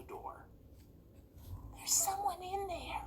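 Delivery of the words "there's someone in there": whisper. Acted. Boy at cabin door whispers to the others, hushed whisper. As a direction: breathe it, ear to the door. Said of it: "he whispered".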